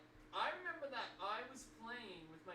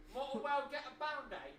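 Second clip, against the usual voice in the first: mock British accent, higher and louder; 7 semitones higher, 8 dB louder than usual. Shown as a demonstration. Kid redoing the Band-Aid line in a British accent. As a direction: put on a posh British voice for the line. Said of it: "I said it in a British accent".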